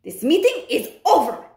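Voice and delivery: loud, high commanding witch; witch shouting. Acted. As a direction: bark out the dismissal. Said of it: "she shouted".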